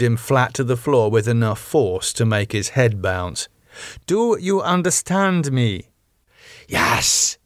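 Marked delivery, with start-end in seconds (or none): 4.1-5.9 stern demand. Acted. Rose pinning Damien to the floor, stern demand. Repeats after shoving him down. 6.7-7.4 unrecognizable monstrous whispered hiss; frightening hiss. Acted. Damo answering Rose while starving, frightening hiss. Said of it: "hissed in a voice that was frightening".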